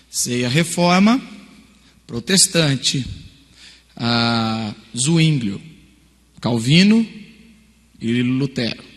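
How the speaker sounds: slow search typing. Retold or imitated. Student typing longer search.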